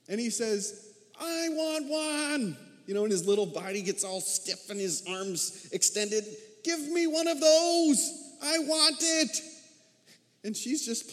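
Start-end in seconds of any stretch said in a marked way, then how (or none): 1.2-2.6 put-on much higher, slow, stretched childish demand; little boy demanding. Pastor imitating his four-year-old boy wanting a toy, demanding. 6.6-9.4 put-on much higher, slow, stretched childish demand; little boy demanding. Pastor imitating his four-year-old boy wanting a toy, demanding.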